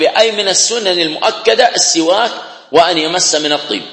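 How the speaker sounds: usual speaking voice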